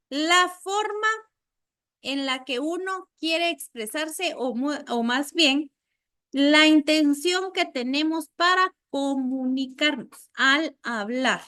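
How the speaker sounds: ordinary talk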